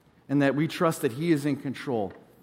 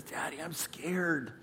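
put-on much softer, slow little-boy voice; hushed scared son at the door; scared child; 12 dB quieter, much slower than usual. Son at parents' door at night, scared and hushed. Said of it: "and he says".

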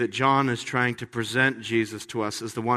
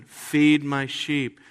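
slow reading; much slower than usual. Acted. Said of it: "Again, verse seventeen".

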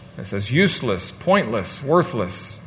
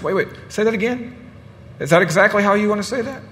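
acted challenge; 8 semitones higher than usual. Elder interrupting lawyer again.

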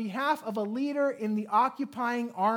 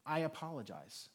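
much softer, low, heartfelt; sincere apology; 4 semitones lower, 10 dB quieter than usual. Real, not acted. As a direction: offer the apology quietly. Pastor apologizing to the hurt.

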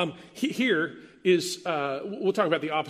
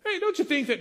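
much higher coaxing ask; coaxing leading question; 11 semitones higher than usual. Retold or imitated. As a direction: fish for agreement sweetly. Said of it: "you fill in the blank".

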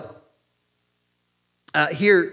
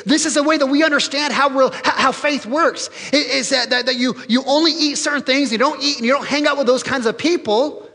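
high, insistent lecturing voice; religious leaders; 8 semitones higher, 4 dB louder than usual. Acted. Leaders explaining their rules, smug and high. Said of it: "religious leaders are saying".

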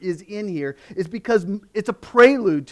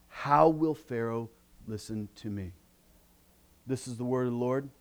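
scripture reading; 5 semitones lower than usual. Acted. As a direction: read the text with solemn care. Reads scripture before the sermon.

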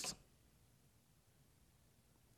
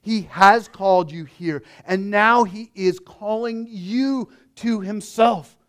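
ordinary talk, nothing marked

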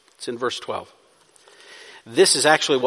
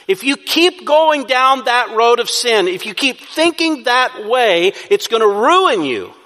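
high, loud, insistent warning; urging; 8 semitones higher, 5 dB louder than usual. Shown as a demonstration. Preacher warning a sinner of ruin, loud urging. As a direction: drive the warning home with force. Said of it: "You urge".

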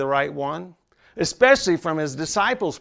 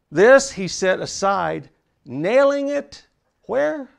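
higher, slow and emphatic; climbing preaching; 7 semitones higher, 4 dB louder, slower than usual. Acted. Preacher drives toward the cross for the congregation, slow and rising. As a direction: lift it to the cross.

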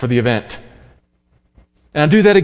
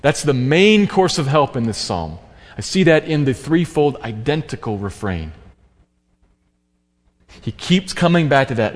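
usual speaking voice

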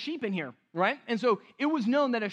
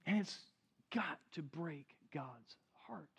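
grieved whisper; 4 semitones lower, 11 dB quieter than usual. Real, not acted. Grieving over fake shepherds.

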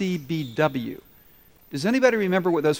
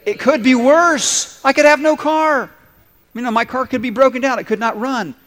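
1 loud; 7 semitones higher, 5 dB louder than usual. Shown as a demonstration. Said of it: "Can we say 'em right now?".

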